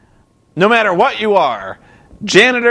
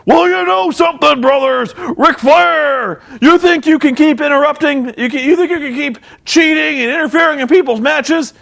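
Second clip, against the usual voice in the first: Hulk Hogan; 9 semitones higher than usual. Retold or imitated. Hogan on the mic calling out Flair, mock promo. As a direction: cut a blustering wrestling promo. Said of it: "And he's like".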